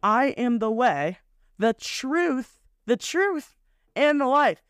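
"the truth" lifted high and repeated with weight; emphasizing the truth. Shown as a demonstration. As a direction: lean hard on "the truth", said twice, rising each time. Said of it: "I wanna emphasize".

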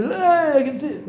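put-on high, excited little boy's voice; imitating his young son. Father retells his son calling out in the headset, high and excited. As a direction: squeak it out like an eight-year-old boy.

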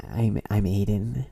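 slow, loud caricature of a friend; imitating the friend. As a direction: exaggerate his awkward self-introduction, drawn out. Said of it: "What is that impression of me?".

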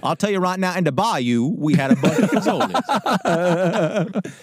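Southern storyteller, slowing at the end; hammed-up Louisiana drawl, laughing at the end; mock bayou accent. Co-host reminiscing about the bayou, mock Southern accent. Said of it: "we're still doing accents".

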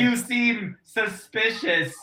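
put-on wary villager in a joke Romanian accent; mock Romanian accent. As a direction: stretch it out in a hammy Romanian accent.

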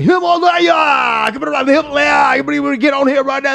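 high, stretched-out hype-man yell; ride operator impression. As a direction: imitate a carnival ride announcer drumming up riders. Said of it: "The guy talking was always like".

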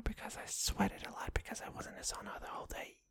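fast breathy whisper aside. Real, not acted. Vlogger whispering an aside to viewers, fast and hushed. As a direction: drop to a hurried whisper.

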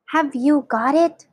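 plain reading voice

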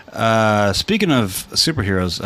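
ordinary talk, nothing marked

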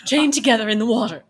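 loud, quick melodramatic cry; theatrical, insistent reminder; impassioned outburst. Acted. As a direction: burst out with hammed-up passion.

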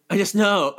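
hammed-up insistent reply; mock insistence. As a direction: imitate someone stubbornly sure without a reason. Acted insistent reply in retold scene.

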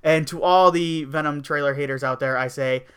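normal talking voice

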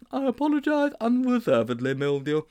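put-on high boyish character voice; high-pitched contrite schoolboy; apologetic Sponge. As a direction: apologise up high, easing down at the end.

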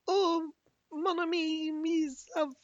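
put-on high, soft French orphan-girl voice; softly pleading, high-pitched mock French; helpless French waif. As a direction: imitate a pitiful little French girl. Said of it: "she just sounds utterly helpless".

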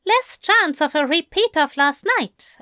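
high-pitched, lilting angel voice; teasing angel. A narrator's reading. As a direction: pitch it up for the angel's light reply. Angel teasing his partner in story.

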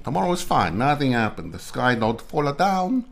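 a hammed-up Italian accent. Shown as a demonstration. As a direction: keep the playful Italian lilt. Continues the Italian voice.

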